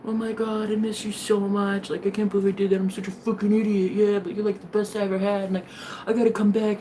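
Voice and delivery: mock pleading ex, a touch put-on; hint of a whiny groveling ex. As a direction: play the sorry ex begging lightly.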